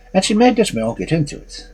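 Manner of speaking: plain reading voice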